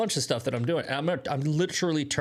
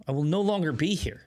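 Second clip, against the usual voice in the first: ordinary talk, nothing marked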